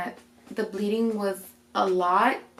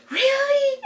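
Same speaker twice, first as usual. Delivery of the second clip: high, squeaky imitation of a crying mother; mock-bawling squeal; tearful disbelief. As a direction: mimic a weepy, very high-pitched "really". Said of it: "she's bawling her eyes out".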